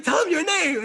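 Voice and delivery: high, loud mock-mom order; hammed-up impatient mom voice; exasperated mom. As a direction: imitate a mother prodding her child to answer. Mom prompting her child, acted.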